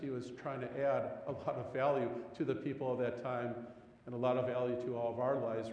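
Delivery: ordinary talk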